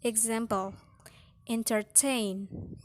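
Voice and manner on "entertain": "entertain" slowly, with TAIN stressed; final-syllable stress. Shown as a demonstration. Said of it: "final syllable is strong".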